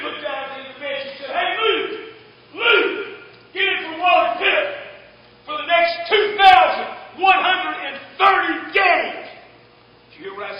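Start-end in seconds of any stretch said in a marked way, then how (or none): 1.3-9.3 loud high call, last phrase slowed and drawn out. Retold or imitated. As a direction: shout the name, then stretch the game count. Manager calling Lou off bench.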